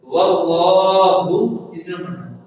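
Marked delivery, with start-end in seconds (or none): 0.0-1.3 slow واللہ with a thick, held "llaah"; heavy lam. Shown as a demonstration. Teacher demonstrates the holy name, slow heavy-lam واللہ. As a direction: chant واللہ, making the lam heavy and long. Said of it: "موٹا کر کے پڑھنا ہے".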